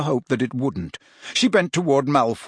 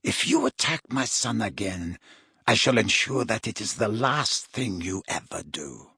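soft, low, icy warning; cold threat; 3 semitones lower, 5 dB quieter than usual. A narrator's reading. Mother warning her son's attacker, coldly menacing. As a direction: threaten quietly and deliberately. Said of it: "she said coldly".

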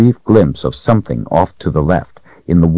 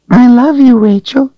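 very high, tiny doll voice; 6 dB louder than usual. Acted. The doll speaks to Rachel.

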